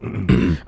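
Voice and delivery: clearing the throat; a quick phlegmy throat clear. Real, not acted. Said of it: "excuse me, let me clear my throat".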